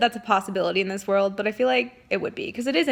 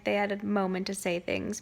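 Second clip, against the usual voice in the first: ordinary delivery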